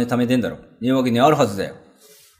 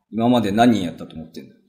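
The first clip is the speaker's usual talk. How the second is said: normal talking voice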